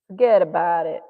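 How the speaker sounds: put-on loud Italian-American New York accent. Painter showing the Italian American phrase, New York Italian accent. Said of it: "Italian American in New York City".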